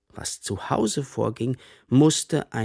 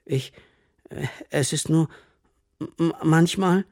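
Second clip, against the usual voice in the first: halting, higher-pitched false starts; hesitant stammer; 6 semitones higher than usual. A narrator's reading. Pupil starts confiding in headmaster, stammering hesitantly. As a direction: falter between words, unsure whether to confess.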